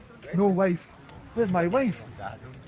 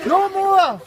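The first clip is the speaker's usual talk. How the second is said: higher and louder stern "no more"; wife scolding; 11 semitones higher, 6 dB louder than usual. Acted. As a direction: put your foot down like a fed-up wife. Wife scolding drinking husband.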